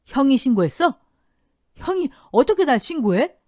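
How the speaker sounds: high, loud, disbelieving yell; betrayed, incredulous outburst; outraged shout. A narrator's reading. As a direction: shout accusingly at your brother. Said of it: "남편이 아주버님을 향해 소리쳤습니다".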